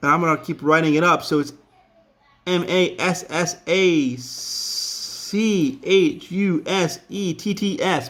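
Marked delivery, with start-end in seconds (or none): 2.4-8.1 slow spelling. Shown as a demonstration. Spells while writing.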